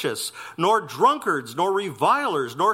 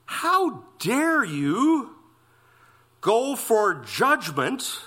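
"how dare you" much higher and very slow; emphatic rebuke; 4 semitones higher, much slower than usual. Acted. Preacher hammers Paul's rebuke.

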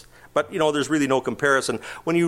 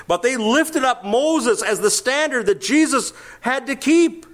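rising preaching build; 10 semitones higher than usual. Acted.

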